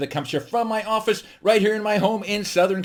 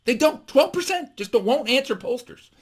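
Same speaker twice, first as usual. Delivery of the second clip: normal talking voice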